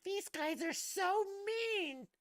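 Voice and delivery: very high, slow, whiny mocking voice; mock offended critic. Mocking people who got upset, in a very high slow whine. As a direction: sneer it out like a pearl-clutching critic, slowly.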